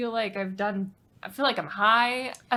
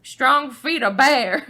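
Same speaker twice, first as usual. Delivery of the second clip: put-on Southern accent; 4 semitones higher, 10 dB louder, much slower than usual. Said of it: "Southern accent".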